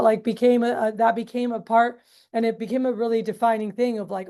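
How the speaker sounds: ordinary delivery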